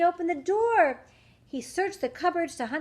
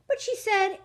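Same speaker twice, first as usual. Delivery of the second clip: ordinary delivery, nothing marked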